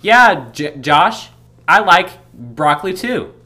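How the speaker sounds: too loud. Shown as a demonstration. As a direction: talk a little too loudly. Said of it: "a little bit too loudly would be like".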